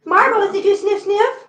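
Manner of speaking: high, bright-pitched question to a pet; pet talk. Real, not acted. Owner asks Marbles about sniffing, high pet talk. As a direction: ask the cat playfully, pitch held high. Asking the cat playfully.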